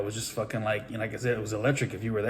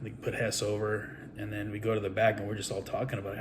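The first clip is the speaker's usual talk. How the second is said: usual speaking voice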